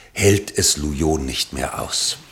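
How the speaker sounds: ordinary talk, nothing marked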